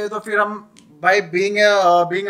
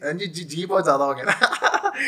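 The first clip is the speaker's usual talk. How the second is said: laughing; 3 semitones lower than usual. Real, not acted. Chuckles at own role-played address.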